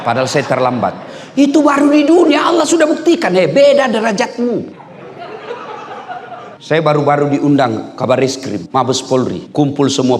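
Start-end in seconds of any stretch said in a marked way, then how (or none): 1.3-4.8 high, loud triumphant declaration; preach. Real, not acted. Preacher showing audience God raised his rank, loud high preaching. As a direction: proclaim it loud and high to the whole hall.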